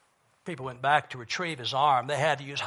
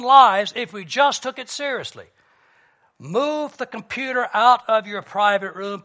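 loud, higher-pitched urgent preaching; forceful preaching; 6 semitones higher, 8 dB louder than usual. Acted. Preacher urging congregation to take a drastic step, forceful preaching. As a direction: drive each command home from the pulpit.

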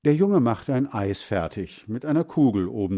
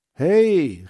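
high, loud shout of protest; a sharp boyish call to stop; 7 semitones higher, 7 dB louder than usual. A narrator's reading. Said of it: "rief Heinz". (lauter, hoher Zuruf)

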